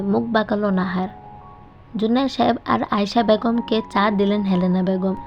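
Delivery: usual speaking voice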